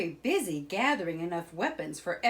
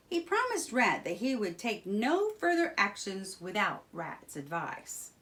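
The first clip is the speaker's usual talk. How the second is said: plain reading voice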